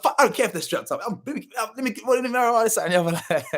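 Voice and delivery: high-pitched mock bravado breaking into laughter; showing off. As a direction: act the drunk tough guy, tumbling over the words. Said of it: "I was showing off".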